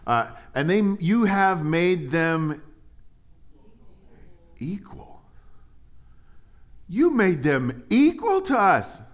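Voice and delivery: loud, high, slow reading, equal whispered; scripture reading. Acted. Preacher reading the workers' complaint, loud and slow.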